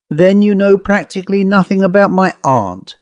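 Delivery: put-on self-possessed young lady. A narrator's reading. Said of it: "pursued the self-possessed young lady".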